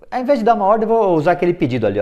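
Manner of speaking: ordinary delivery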